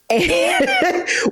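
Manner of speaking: a sudden high laugh that breaks out; burst of laughter. Real, not acted. Laughing at own remark.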